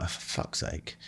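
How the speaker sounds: whispered, fed-up groan of annoyance; muttered exasperation. Retold or imitated. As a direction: breathe it out under your breath, annoyed.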